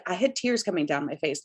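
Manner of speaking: ordinary talk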